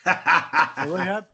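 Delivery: amused echo, laughing through the words. Real, not acted. Repeating the host's joke back to him, laughing. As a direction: repeat it while cracking up. Host jokes contender house was rehab.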